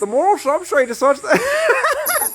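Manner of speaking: high, strained comic impression; hammed-up intellectual mimicry breaking into laughter; Jordan Peterson. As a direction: push the pitch way up and draw it out. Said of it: "That was more Jordan Peterson".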